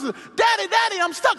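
put-on high, loud child's cry for daddy; a son stuck calling his father; child screaming. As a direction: yell like a kid stuck on the bars. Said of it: "so his son is".

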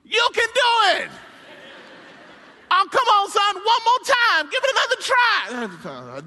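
high, loud cheering on; rally the kid like a coach; father cheering son to climb out; encouraging dad. Retold or imitated. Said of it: "tries to encourage him out".

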